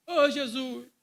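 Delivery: loud, fervent cry of Jesus's name; Pentecostal cry. Retold or imitated. Preacher imitating a Pentecostal cry, loud and fervent. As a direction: call out like a Pentecostal in worship. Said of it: "mais assim, pentecostal".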